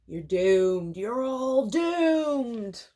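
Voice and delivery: slow ominous raspy drawl; Crazy Ralph. Retold or imitated. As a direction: drag it out like a doom prophet. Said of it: "Who's that? Crazy Ralph that says that".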